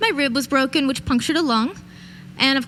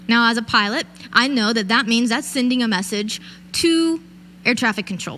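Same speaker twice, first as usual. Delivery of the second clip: normal talking voice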